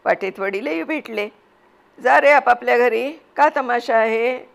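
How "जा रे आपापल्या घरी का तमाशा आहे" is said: put-on louder angry father shooing boys home; angry scolding. Father angrily sends the crowding boys home, loud scolding. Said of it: "वडील मुलांना रागाने बोलले".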